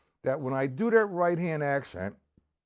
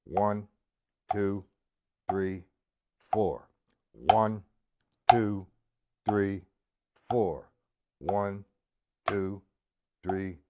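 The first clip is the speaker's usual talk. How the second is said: low, evenly spaced counting, one number per beat; slow quarter-note count; 6 semitones lower, much slower than usual. Shown as a demonstration. Drum teacher redoing line one, slow measured count. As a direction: concentrate and count slowly, keeping strict time. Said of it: "line one again in quarter notes".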